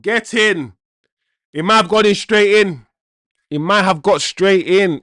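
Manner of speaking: loud, excited yell at a goal; excited shout. Real, not acted. Fan watching the match shouts at a possible goal, loud and excited. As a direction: let it burst out as the ball goes in.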